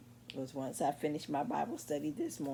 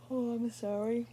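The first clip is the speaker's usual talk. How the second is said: startled oh; 9 semitones higher, 5 dB louder than usual. Real, not acted. Startled mid-video apology.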